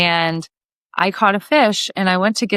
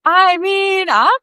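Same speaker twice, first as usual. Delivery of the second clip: higher, louder, through laughter; laughing refusal; 7 semitones higher, 3 dB louder than usual. Retold or imitated. To the fisherman, a laughing refusal to touch his fish. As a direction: refuse while cracking up.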